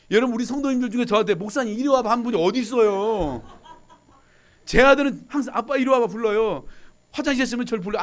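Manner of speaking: loud, high, fervent preaching; preaching at full force. Acted. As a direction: rouse the room with a punchline. Preaching loudly.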